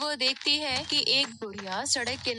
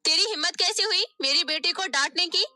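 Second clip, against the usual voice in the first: fast, louder, higher telling-off; angry scolding; 5 semitones higher, 5 dB louder, faster than usual. Acted. Stepmother scolding Nisha for her daughter, angry. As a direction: tear into her.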